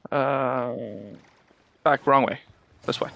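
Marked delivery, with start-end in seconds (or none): none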